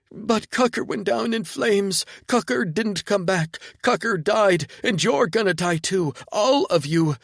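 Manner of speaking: high, loud, unhinged rant; hysterical drugged woman. Acted. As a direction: rave in a manic high voice.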